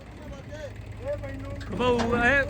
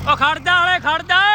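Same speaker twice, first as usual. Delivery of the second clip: high loud call to wait; shouting out; 9 semitones higher, 3 dB louder than usual. Real, not acted. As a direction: yell wait to the driver. Shouting to driver to stop.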